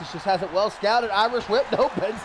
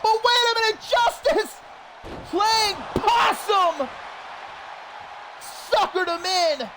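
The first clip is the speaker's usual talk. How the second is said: excited shout; 10 semitones higher than usual. Real, not acted. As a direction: yell it as the trick is revealed. Sudden reversal in the match.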